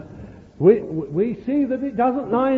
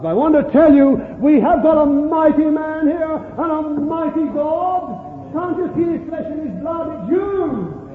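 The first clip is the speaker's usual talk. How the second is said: full-force preaching; 8 semitones higher, 4 dB louder than usual. Real, not acted. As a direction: proclaim it to the whole congregation.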